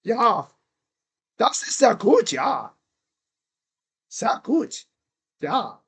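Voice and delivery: mock German accent. Shown as a demonstration. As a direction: streamer hams up German phrases in a mock German accent. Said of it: "Little German accent".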